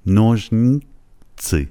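slow نوژنی, break, thick Azeri-like تسی; two parts, thick i. Shown as a demonstration. Teacher modelling the Azeri-like i, نوژنیتسی split slowly. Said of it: "ای دوم رو غلیظ بگید مثل ای های زبان آذری".

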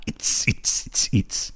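soft voiceless its-its rhythm; car speaker beat imitation. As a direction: mimic a passing car's thumping music in hissed pulses.

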